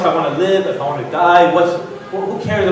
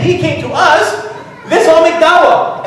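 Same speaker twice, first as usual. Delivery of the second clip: higher, slow and loud, overjoyed; thrilled brothers rallying each other; eager excitement; 9 semitones higher, 4 dB louder, slower than usual. Retold or imitated. Brothers excited about newcomer.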